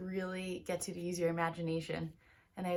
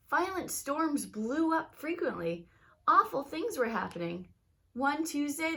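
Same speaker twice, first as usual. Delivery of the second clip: lively read-aloud storytelling voice; story narration; 5 semitones higher, 4 dB louder than usual. A narrator's reading. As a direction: tell it like a bedtime story to kids. Teacher reads picture book aloud.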